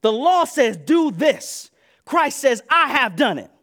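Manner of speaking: loud, high declaration; loud preaching contrast. Real, not acted. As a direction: proclaim the contrast.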